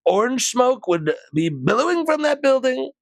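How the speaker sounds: hammed-up boastful tycoon voice; Trump impression. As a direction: brag it up high like a pompous president. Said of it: "He's like".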